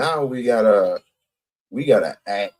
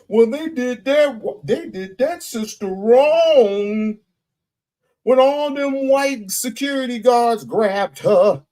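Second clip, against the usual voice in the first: mocking impression; 10 semitones higher than usual. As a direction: parody the self-righteous crowd, pitched high. Mocks hypocrites in a quote.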